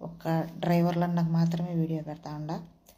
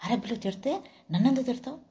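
higher retold retort, mildly indignant; scolding back; 6 semitones higher than usual. Driver scolding the daughter back, higher mock retort. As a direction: throw it back at her, pitched up. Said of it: "వాడు తిరిగి తిట్టిండు".

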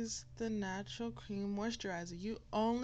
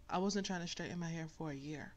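ordinary talk, nothing marked

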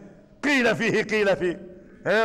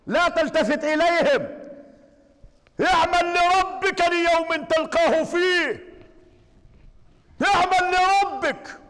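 raised, fervent preaching; preaching at full force; 6 semitones higher than usual. Acted. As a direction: drive the lines home to the congregation. Exhortation to ignore critics.

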